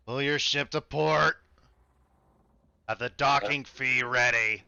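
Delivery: slow, gruff dockmaster; gruff orc. Acted. Orc demanding the docking fee from arriving crew, gruff and slow. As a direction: give orders as a gruff orc. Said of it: "the gruff voice was the orc".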